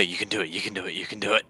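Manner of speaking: soft, low-key mock pep talk; quiet encouragement. Standing next to the druid to assist, quiet mock encouragement. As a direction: murmur repeated encouragement under your breath.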